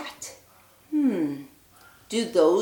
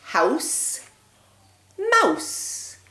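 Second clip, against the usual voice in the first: mouse much higher, falling; rhyme modelled; 7 semitones higher than usual. Shown as a demonstration. Teacher modelling a rhyme for children, sing-song. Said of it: "Let's say 'em together".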